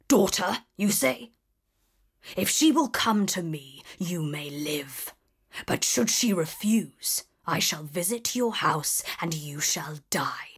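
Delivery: low whispered beast's threat; menacing beast. A narrator's reading. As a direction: hush it down to a deadly bargain. Beast answers merchant.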